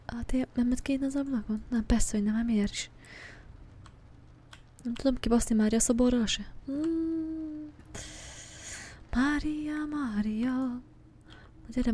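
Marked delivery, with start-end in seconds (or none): none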